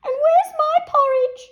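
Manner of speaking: very high, loud little bear voice; Baby Bear wailing about porridge; Baby Bear crying out. A narrator's reading. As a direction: squeak it like a tiny bear. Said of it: "cried Baby Bear".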